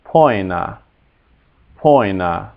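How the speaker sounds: "poina" as POY-na; careful Latin "poina", first syllable poy; oi diphthong. Shown as a demonstration. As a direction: say "poina" with the vowel of boil. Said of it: "as in boil".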